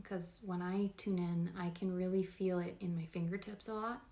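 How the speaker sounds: ordinary talk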